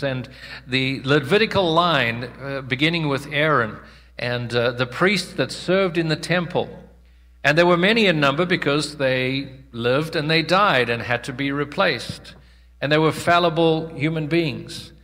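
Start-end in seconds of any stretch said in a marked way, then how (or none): none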